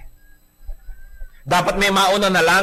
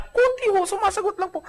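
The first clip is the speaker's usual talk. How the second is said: much higher, small timid voice; coy high-pitched mock answer; mock meek falsetto. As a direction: play the innocent little wife. Meek wife's mock reply.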